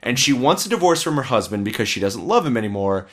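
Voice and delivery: normal talking voice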